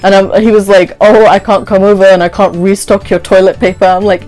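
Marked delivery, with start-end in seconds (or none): none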